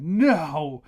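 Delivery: loud, high, slow disbelieving protest; shocked protest. Retold or imitated. Gasping at the book's ending, loudly protesting in mock shock. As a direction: plead at the book as if the author betrayed you. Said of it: "I literally gasped out loud near the end of it, and I said".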